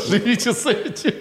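high, laughing through the words. Real, not acted. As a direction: laugh as you repeat it. Repeats his own joke, laughing.

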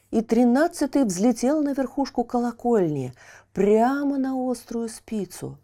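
plain reading voice

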